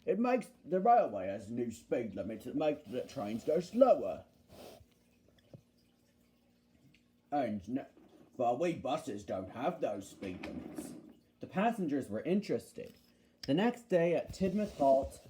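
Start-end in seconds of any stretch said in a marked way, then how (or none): 0.1-10.9 low, explaining bus character; Bulgy. Acted. Bulgy explaining speed limits to passengers, low-voiced. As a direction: explain it in a lowered voice. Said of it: "he explained to the passengers".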